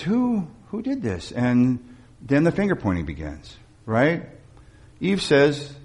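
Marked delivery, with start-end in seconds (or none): 0.0-1.3 a higher, softer voice for God demanding answers; God asking who is to blame; booming God voice. Acted. Preacher voicing God asking who ate the fruit, higher and softer. God confronting Adam and Eve.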